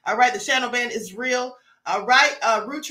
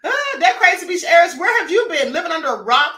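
loud, higher, playful call-out; teasing shout; 5 semitones higher, 7 dB louder than usual. Real, not acted. Host teasing a viewer who hasn't heard, loud mock shout. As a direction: holler at them like they missed the obvious.

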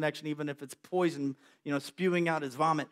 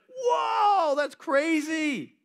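much higher shout, then slow drawn-out; amazed whoa; 10 semitones higher, 3 dB louder, much slower than usual. Acted. Elijah watching the wind tear the mountain, amazed. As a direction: gasp at the mountain splitting.

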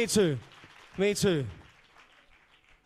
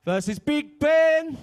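slow, drawn-out, louder and higher announcer call; hype reveal; 7 semitones higher, 5 dB louder, much slower than usual. Real, not acted. Host announcing the matchup to the crowd, stretched hype call.

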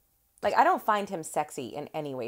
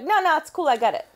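put-on cocky kid; 9 semitones higher, 7 dB louder than usual. Kid brushing off help.

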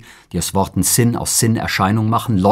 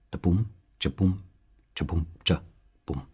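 hushed drum sounds; 6 dB quieter than usual. Acted. Soft drum-beat sound poem.

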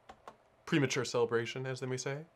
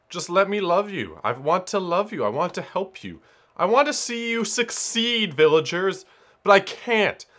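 hammed-up, higher-pitched plea; melodramatic pleading; 5 semitones higher, 3 dB louder than usual. Player begs the missing villagers to accept help, melodramatic plea. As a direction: beg them like a rejected would-be helper, overdone.

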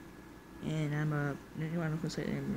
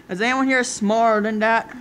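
ordinary talk

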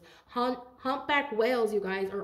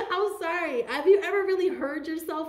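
laughing; 8 semitones higher, 5 dB louder than usual. Real, not acted. Laughing while ranting.

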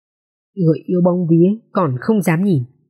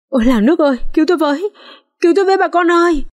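much higher, frantic calling out; crying for help; 10 semitones higher, faster than usual. A narrator's reading. Maid banging on neighbours' doors, frantic cries for help. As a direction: raise the whole street, panicked. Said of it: "đập cửa hô hoán".

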